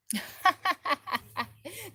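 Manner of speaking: high, rapid burst of laughter. Real, not acted. Laughs at what children painted.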